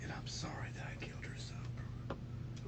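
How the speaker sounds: soft, breathless whisper, barely audible; whispered apology. Real, not acted. Husband confessing to detectives, whispering his regret.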